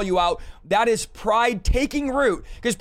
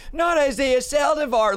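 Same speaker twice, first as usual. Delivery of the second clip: imitating fans, higher and slower; hammed-up objection in a raised, drawn-out voice; mock protest; 5 semitones higher, slower than usual. Guest voicing listeners who can't believe he gets corrected, mock protest. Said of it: "I know you guys like".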